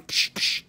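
unvoiced psh sound effect; whooshing psh noise. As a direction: puff a swatting whoosh. Imitating knocking witches down.

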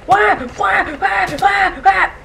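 high repeated shouts of why; frustrated yelling. Real, not acted. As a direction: yell it over and over at the screen. Frustrated at the game dying.

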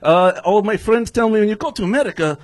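stand-up comic's put-on Russian accent; hammed-up Russian English; fake Russian accent. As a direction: play a Russian immigrant with a thick accent. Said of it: "somebody's doing a fake Russian accent".

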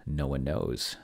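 ordinary talk, nothing marked

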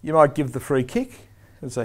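ordinary talk

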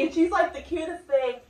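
ordinary talk

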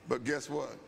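normal talking voice

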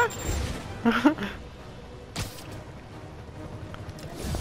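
big breathy laugh. Real, not acted. Streamer laughs at the game. (risa suelta)

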